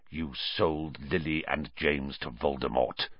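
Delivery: low, quiet accusation; soft and low, deadly serious; suppressed fury. A narrator's reading. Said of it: "who was shaking too".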